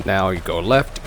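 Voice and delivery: normal talking voice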